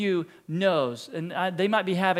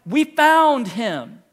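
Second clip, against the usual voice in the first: slow, loud "We found him"; triumphant announcement; 4 semitones higher, 7 dB louder, much slower than usual. Retold or imitated. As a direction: proclaim the news. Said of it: "we've been waiting".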